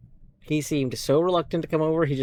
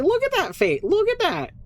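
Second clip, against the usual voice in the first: much higher, gushing over a cute face; 3 dB louder than usual. Real, not acted. To viewers about the cat's face, high gushing.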